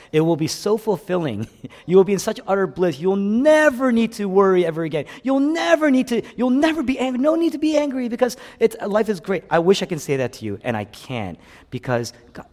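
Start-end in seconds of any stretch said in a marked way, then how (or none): 1.9-9.4 high, loud, gushing sales pitch; mock blissful promise. Pastor parodying what he wishes he could promise, mock bliss. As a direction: gush with over-the-top assurance. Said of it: "I wish I can tell you".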